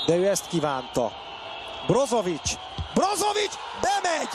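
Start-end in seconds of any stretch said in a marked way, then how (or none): 1.9-4.3 name rising to a scream; excited goal call. Real, not acted. Goal called live.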